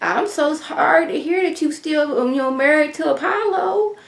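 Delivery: high, soft, syrupy fake pity; fake sympathy. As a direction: coo it sweetly while twisting the knife. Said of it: "She's like".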